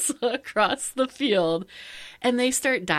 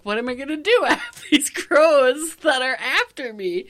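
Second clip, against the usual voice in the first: louder, higher mock alarm; retold freak-out; 6 semitones higher, 5 dB louder than usual. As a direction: replay your panic with a laugh. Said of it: "I'm all freaked out".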